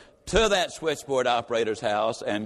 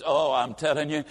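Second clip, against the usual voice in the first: normal talking voice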